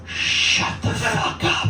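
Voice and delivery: slow, high, breathy whisper; whispered hush. Pretend. Comedian whispering slowly to his girlfriend in bed. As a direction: hiss it gently right against her mouth.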